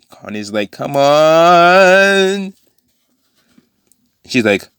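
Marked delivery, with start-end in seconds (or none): 0.7-2.5 pleading character voice. Acted.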